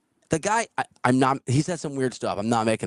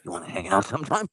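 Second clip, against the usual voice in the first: lower, coaxing mock of a clingy stranger; creepy drifter voice; 7 semitones lower than usual. Retelling the drifter asking him to play, in a lower mock voice. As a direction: lean in like an awkward pest.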